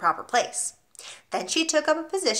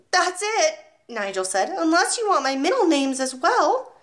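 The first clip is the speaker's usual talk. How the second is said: put-on 1 cheeky small boy; 7 semitones higher, 3 dB louder than usual. Brave boy answers headmistress.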